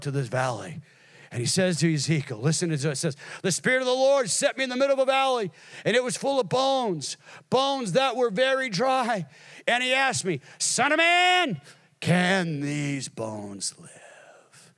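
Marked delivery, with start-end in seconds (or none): 3.4-11.6 fervent scripture preaching. Real, not acted. Declaims scripture at full force. 12.0-14.7 very slow, drawn-out question; solemn question. Acted. Verse's question after the climax.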